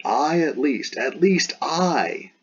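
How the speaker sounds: "I" stretched out and pitched high; emphatic I. Shown as a demonstration. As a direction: lean hard and slowly on "I". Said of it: "this is an emphatic I".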